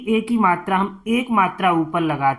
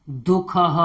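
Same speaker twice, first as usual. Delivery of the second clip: ordinary delivery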